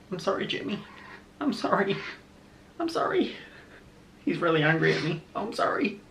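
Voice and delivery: high, soft cooing sorry; soft apology to pet. Real, not acted. To her startled pet Jimmy, soft high apology. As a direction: soothe the upset pet gently.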